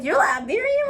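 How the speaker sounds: high, surprised "You're Liberian?"; mock disbelief from others; surprised question. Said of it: "Everybody's like".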